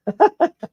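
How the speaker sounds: quick high laugh; a short burst of laughter. Real, not acted. Laughing to himself while listing parts.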